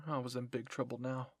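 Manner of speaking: ordinary delivery, nothing marked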